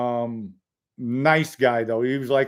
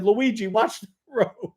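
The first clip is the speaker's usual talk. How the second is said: laughing warning; 8 semitones higher than usual. Retold or imitated. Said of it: "She said".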